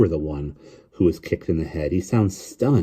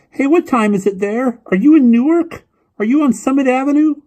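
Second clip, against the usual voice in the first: put-on high, loud child's voice full of questions; piping boy on a long-distance call; eager questioning boy; 9 dB louder than usual. A narrator's reading. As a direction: fire off questions as an excited young boy.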